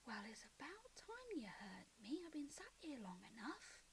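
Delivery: soft, breathy whisper with swooping pitch. Acted. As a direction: whisper it like an unseen presence. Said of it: "the whispering comes in and out".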